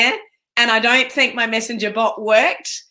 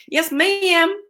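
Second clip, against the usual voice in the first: ma'am stretched to "mee-am" with an American twang, pitched higher; hammed-up American accent; 8 semitones higher than usual. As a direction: ham up the American "Yes, ma'am" on a second go. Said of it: "Did I say it with the right accent?".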